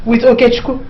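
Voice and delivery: ordinary delivery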